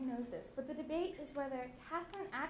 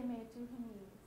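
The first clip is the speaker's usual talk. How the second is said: submissive; 3 semitones lower, 8 dB quieter than usual. Acted. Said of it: "submissive person we just described".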